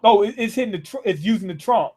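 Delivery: ordinary talk, nothing marked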